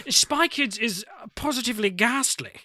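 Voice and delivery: high, hammed-up upper-class English; posh British accent. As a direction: go as posh British as you can. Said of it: "I wanna hear you go posh".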